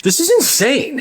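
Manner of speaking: high outraged disbelief; incredulous. Real, not acted. Narrator reacts to bathroom fees, high and outraged. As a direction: pitch it up in disbelief.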